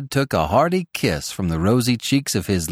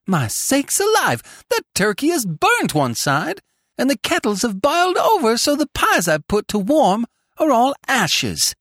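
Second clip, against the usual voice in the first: high-pitched dismayed scold; 12 semitones higher than usual. A narrator's reading. Tilly scolds over her spoiled dinner, high scolding. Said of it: "scolded Tilly".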